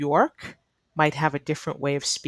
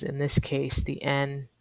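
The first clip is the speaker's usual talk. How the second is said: ordinary delivery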